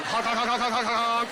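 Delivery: playful high honks while grabbing; mock honking. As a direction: honk honk like a goofy prank. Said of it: "him coming up behind you going".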